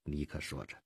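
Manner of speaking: ordinary delivery, nothing marked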